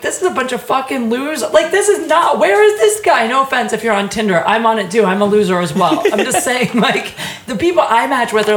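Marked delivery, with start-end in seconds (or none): 0.0-3.3 vent, building to disbelief; exasperated rant. Retold or imitated. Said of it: "I'm like".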